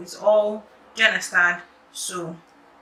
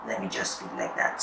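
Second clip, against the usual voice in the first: defeated whisper; 9 dB quieter, faster than usual. Real, not acted. Tired sigh after gasp.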